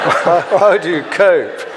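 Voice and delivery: mock sympathy. Author teasing Danish host about lacking aristocracy, mock sympathy.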